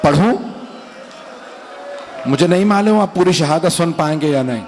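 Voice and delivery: ordinary talk, nothing marked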